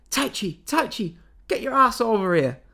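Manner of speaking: hollering for your partner to come help, hammed up; imitated yelling. Reviewer imitating a wrestler yelling at his partner, loud mock call. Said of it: "you just hear Zack for ages going".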